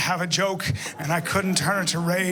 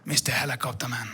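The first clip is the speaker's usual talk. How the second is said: lower, softer pointed jab; low deadpan put-down; dry jab; 8 semitones lower, 6 dB quieter than usual. Retold or imitated. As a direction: needle him quietly. Guide's pointed dig.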